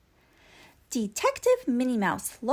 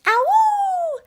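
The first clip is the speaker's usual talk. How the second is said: high loud dog howl; a long joyful dog howl; happy howl; 9 dB louder than usual. Acted. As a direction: howl for joy. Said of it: "Pluto howled with happiness".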